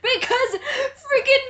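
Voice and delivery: half-laughing, half-crying in frustration; laughing in disbelief. Real, not acted. Exasperated rant at bad writing.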